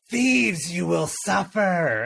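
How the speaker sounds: high, slow menacing dragon cry; dragon threat. Acted. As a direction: roar a threat at the thieves.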